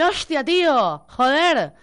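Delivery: imitating a Spaniard swearing, drawn out; hammed-up Spain Spanish "Hostia, tío, joder". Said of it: "Te va a hablar con el".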